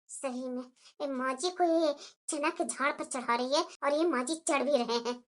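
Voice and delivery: elder bahu scoffing quietly at the flattery; sarcastic aside. Acted. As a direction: mutter the jibe under your breath.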